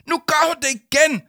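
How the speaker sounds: high, shrieked accusation; very high, loud tattling shriek; screaming. Acted. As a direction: scream it in outrage. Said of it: "skreg Victoria".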